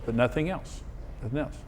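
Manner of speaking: soft, hushed inner voice; quiet inner voice. Retold or imitated. Husband retells the Holy Spirit speaking, hushed and soft. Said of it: "he said".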